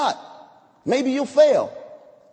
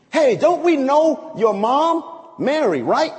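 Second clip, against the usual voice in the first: mocking heckler; 6 semitones higher, 4 dB louder, slower than usual. Heckler mocks Jesus' family.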